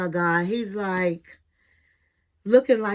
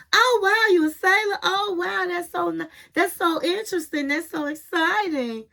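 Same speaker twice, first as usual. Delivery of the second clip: higher, gushing imitation of Chantel; mock excited gush; 7 semitones higher than usual. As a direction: play up her fake fascination with the sailor. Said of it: "And she was".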